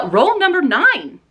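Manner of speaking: drawn-out announcer; announcer roll call. Real, not acted. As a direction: call it like a game show host.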